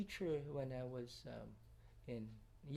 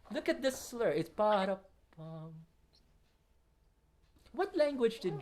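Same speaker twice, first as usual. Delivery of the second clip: ordinary talk, nothing marked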